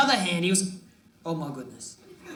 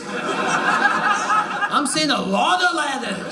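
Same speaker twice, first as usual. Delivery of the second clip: slow, drawn out; 5 semitones higher, much slower than usual. Acted. Slowed, drawn-out repeat.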